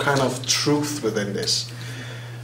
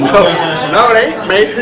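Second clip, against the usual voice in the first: a quick jokey Aussie twang, higher and louder; mock Australian; 5 semitones higher, 7 dB louder than usual. Danish speaker joking that he sounds Australian, mock Aussie accent. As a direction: play up a fake Australian accent for laughs. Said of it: "Is it Australia?".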